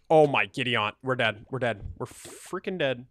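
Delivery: loud panicked gasp of danger; loud alarm that the character is about to die. Real, not acted. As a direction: blurt it out in a game panic.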